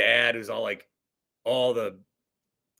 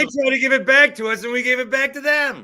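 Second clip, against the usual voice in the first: high, raised-voice exasperation; worked-up fan complaint, pitched up; exasperated outburst; 9 semitones higher, 5 dB louder than usual. Real, not acted. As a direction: burst out in disbelief at both teams. Exasperated outburst about giving game away.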